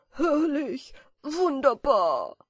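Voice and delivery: loud, rapturous cry of delight; jubilant. A narrator's reading. Moth dancing through the attic, loud and jubilant. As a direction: shout out your joy at flying. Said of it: "jubelte Flick".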